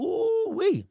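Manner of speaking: ooh-wee shot way up high; high, gleeful hooted ooh-wee. Real, not acted. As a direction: whoop it like a delighted hoot. Gloating over rivals' playoff trip.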